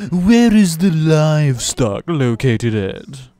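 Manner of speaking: drawn-out, loud, haughty cartoon voice; Stewie Griffin. Asking after livestock at the fair, in a hammed-up cartoon voice. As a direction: stretch it out high and over-proper. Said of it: "It's me, Stewie Griffin".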